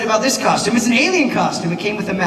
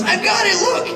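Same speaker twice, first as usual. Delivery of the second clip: put-on much higher, laughing little kid's boast; excited little boy; 3 dB louder than usual. Son shouting to dad that the milkshake worked, excited high kid voice. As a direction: squeal it like a proud five-year-old.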